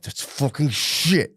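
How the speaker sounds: mock-anguished, drawn-out realisation; mounting dismay. Grief stages, mounting dismay.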